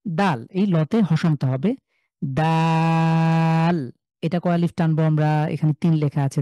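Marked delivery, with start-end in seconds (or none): 2.2-3.9 দাল with the vowel drawn out very slowly; long daal. Shown as a demonstration. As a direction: stretch the a of দাল for three counts. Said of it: "তিন second long করে পড়বো".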